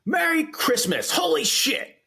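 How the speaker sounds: a mock meltdown, voice cracking up high; Clark Griswold rant. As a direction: snap like a dad whose Christmas is falling apart. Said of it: "when he's like".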